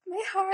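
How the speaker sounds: high gasp into a hammed-up cry; mock heartbroken gasp. Acting out the viewer's reaction to Olivia, high mock sob. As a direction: gasp like your heart just broke. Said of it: "And you're like".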